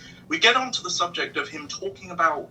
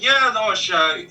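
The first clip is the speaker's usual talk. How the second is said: louder New York cabbie voice; higher chatty Brooklyn driver; New York accent; 6 semitones higher, 6 dB louder than usual. Retold or imitated. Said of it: "your New York voice is great".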